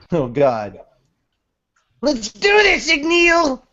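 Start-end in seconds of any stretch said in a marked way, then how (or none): 2.0-3.6 eager battle cry. Acted. Natsu calls out to Igneel.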